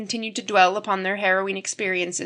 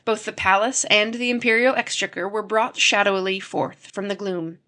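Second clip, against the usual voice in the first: ordinary delivery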